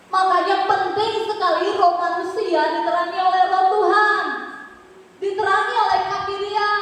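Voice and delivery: high, forceful sermon climax; rising preaching. Real, not acted. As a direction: proclaim it to the congregation. Preaching rises to a proclamation. (khotbah lantang bernada tinggi)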